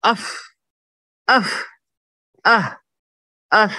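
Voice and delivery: sharp high cut-off اف with the breath held back; wrong way, held breath. Shown as a demonstration. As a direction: cut each اف short and high as if stopping the breath. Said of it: "غلط پڑھنا ہے تو کیسے".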